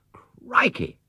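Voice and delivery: high startled cry; boy's startled high exclamation; sudden exclamation. A narrator's reading. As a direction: burst out in surprise. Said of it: "exclaimed Jonathan suddenly".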